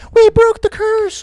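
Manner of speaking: put-on high, loud, slow childish chant; mock a squeaky little kid cheering. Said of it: "a bunch of third graders were like".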